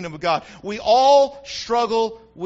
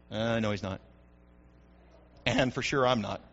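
1 doubtful Gideon; 12 semitones lower, 6 dB quieter than usual. Acted.

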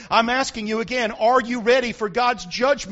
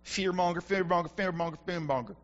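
tongue twister; 8 semitones lower, 9 dB quieter than usual. Shown as a demonstration. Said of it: "that's hard to say a bunch of times".

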